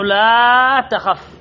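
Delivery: slow, with لا held very long; long, lingering لا before تخف; drawn-out example. Shown as a demonstration. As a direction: stretch the vowel of لا out, then finish on تخف. Teacher draws out the quoted example.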